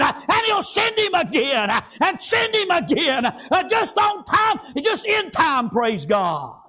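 high, shouted revival cadence; fired-up preacher in full cry; full-force preaching. Real, not acted. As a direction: shout it out in driving, rhythmic phrases.

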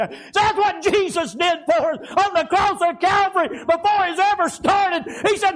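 high, loud revival preacher's shout; full-force preaching. Real, not acted. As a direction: preach it at the top of your voice, pitched high.

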